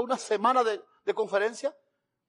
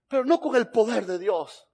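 ordinary talk, nothing marked

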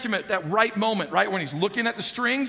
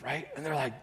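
ordinary delivery

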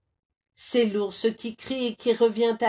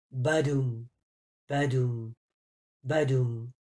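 low badoum; 7 semitones lower than usual. A narrator's reading. Nut bouncing.

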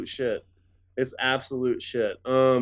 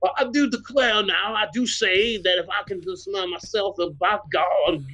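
hammed-up plantation-owner Southern speech, mocking; overdone Southern accent; 7 semitones higher than usual. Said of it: "and you're going".

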